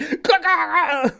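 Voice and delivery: high-pitched mock spasm noise; imitated fit noise. Streamer mimicking Riss's little fit, high silly noise. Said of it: "he just, like".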